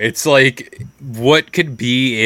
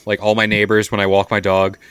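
usual speaking voice

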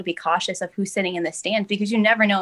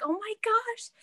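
high, slow squeal of delight; excited squeal; slower than usual. Retold or imitated. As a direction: gush with sudden joy. Excited greeting of coach.

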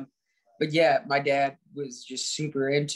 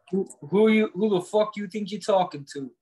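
put-on Boston tough-guy accent; 5 semitones higher than usual. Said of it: "now you turned it into, like, Boston".

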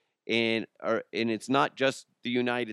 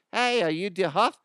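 higher-pitched mock Aussie challenge; Australian accent; 8 semitones higher, 4 dB louder than usual. Aussie stranger accosting the host in the street, mock Australian accent. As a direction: call out as an imitation Australian. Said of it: "all these Aussies are gonna roll up on me like".

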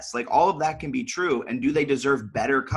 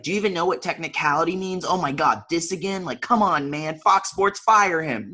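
mock outraged mob; 6 semitones higher than usual. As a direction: play the outraged online fan demanding a firing. Imagined online mob piling on.

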